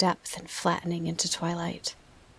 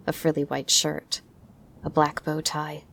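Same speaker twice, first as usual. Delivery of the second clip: plain reading voice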